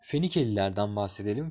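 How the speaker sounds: normal talking voice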